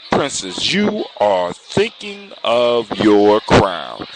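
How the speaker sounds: slow, drawn-out dramatic reveal. A narrator's reading. Prince revealing the princess's thought at the last moment, dramatic. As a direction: draw out each word for suspense. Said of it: "decides to add a sense of drama".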